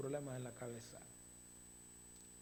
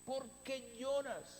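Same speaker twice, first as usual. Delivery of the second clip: scolding; 9 semitones higher than usual. Shown as a demonstration. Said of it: "Es un regaño".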